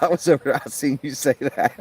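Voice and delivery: mocking friend. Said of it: "she's like".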